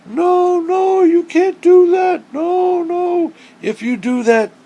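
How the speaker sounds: squeaky high panicked voice, drawn out; mock protest. Everybody warning her not to put the turtle in, squeaky mock panic. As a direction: mimic everyone fussing at the storyteller. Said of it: "everybody was like".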